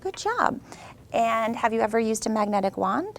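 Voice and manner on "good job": bright high praise; excited teacher praise. Real, not acted. Praising a child.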